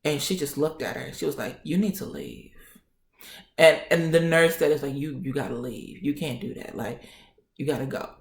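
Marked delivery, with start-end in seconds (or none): 1.6-2.5 quiet order. Retold or imitated. Doctor orders nurse out. 5.0-8.1 softer, lower retold dismissal; quiet order. Nurse being told to leave.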